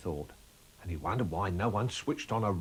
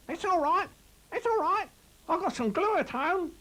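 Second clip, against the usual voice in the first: squeaky rabbit voice; 5 dB louder than usual. Acted. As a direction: squeak it out like a small excited rabbit. Narrator voices Chris Rabbit.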